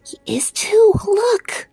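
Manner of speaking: high whispered insistence. A narrator's reading. Edith whispers back to Little Bear, hiding.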